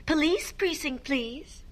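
high, dainty telephone request; sing-song storybook politeness; very polite. Shown as a demonstration. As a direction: ask the operator ever so politely. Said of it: "Can you say very politely".

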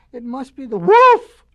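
loud, urgent realisation; sudden alarm. A narrator's reading. Brother realises the wolf is there, loud alarm. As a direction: burst out with it.

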